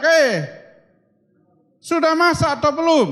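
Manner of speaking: high call from far away, up the path; calling out from afar. Retold or imitated. Toraja guest calling from afar, high and loud. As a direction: shout ahead to ask if food is ready. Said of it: "Dari jauh udah teriak".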